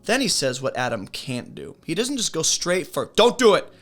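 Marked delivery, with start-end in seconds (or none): 3.2-3.7 sharp, much louder and higher "Don't do it"; blunt command. Retold or imitated. Teacher voicing God's curt ban, loud and abrupt. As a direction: bark out a flat ban like a stern God.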